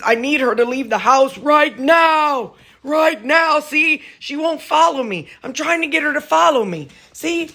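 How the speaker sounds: high, urgent pleading dog voice; frantic dog voice. Acted. Owner voicing her frantic dog wanting her to leave, high and urgent. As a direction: beg urgently as the impatient dog. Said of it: "He's like".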